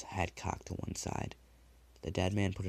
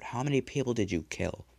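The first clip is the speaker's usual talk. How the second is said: louder, pitched up demand; 5 semitones higher, 5 dB louder than usual. A narrator's reading. Dead man throttles the killer, demanding. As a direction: grip his throat and demand it.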